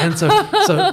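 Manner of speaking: high squeaky laugh through the words; giggly high burst. Real, not acted. Laughs recalling the moment.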